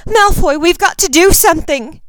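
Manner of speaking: loud, high pleading shout; Ginny crying out to Malfoy; desperate cry. Acted. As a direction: beg for help now.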